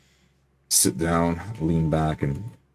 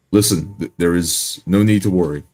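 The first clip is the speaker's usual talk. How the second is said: louder, composed call for attention; raised calm voice; 2 semitones higher, 6 dB louder than usual. Pretend. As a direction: raise your voice calmly to cut through murmuring. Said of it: "raise my voice".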